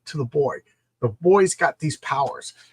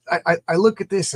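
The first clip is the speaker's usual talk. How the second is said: usual speaking voice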